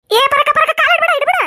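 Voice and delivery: high, loud shrieked warning to stop her foot; frantic cry of danger to the neighbour; panicked warning. Acted. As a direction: scream at her not to step there. Panicked warning not to step.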